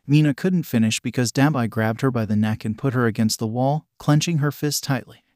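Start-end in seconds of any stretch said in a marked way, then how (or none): none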